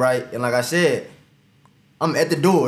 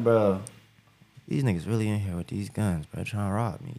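quiet inner thought; 8 semitones lower, 8 dB quieter than usual. Retold or imitated. Inner thought during robbery.

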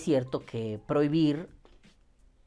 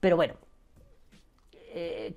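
ordinary talk, nothing marked

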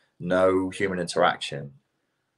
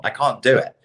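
normal talking voice

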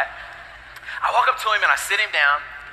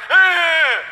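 higher, louder mock baby grunt; mock baby's defiant hum; baby's defiant hmm; 6 semitones higher, 4 dB louder than usual. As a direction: grunt back like a stubborn baby. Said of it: "he goes".

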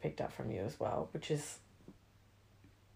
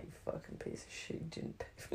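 hushed, much softer, through a laugh; whispered mock insult; 12 dB quieter than usual. Friend skipped paying for coffee, whispered mock insult. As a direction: hiss it half laughing.

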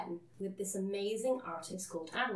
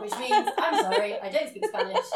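loud, high, through laughter; helpless laughing delivery, louder and higher; 8 semitones higher, 11 dB louder than usual. Real, not acted. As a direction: translate while cracking up at your own joke. Laughing at own Spanish joke.

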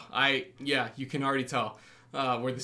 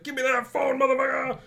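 much higher, hammed-up furious yell; mock action-hero yell; 11 semitones higher, 4 dB louder than usual. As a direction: ham up the climax shout. Cop's climactic line in movie.

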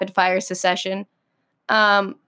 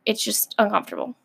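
ordinary talk

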